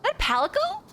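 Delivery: high, loud excited squealing. Real, not acted. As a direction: squeal at a cute kitten. Spotting a cute cat creature.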